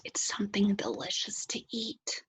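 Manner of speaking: soft whisper, like sharing a secret; a teacher whispering a hint to her class. Shown as a demonstration. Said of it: "I'll give you a hint".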